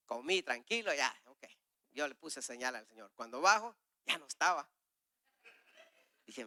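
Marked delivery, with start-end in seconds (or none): none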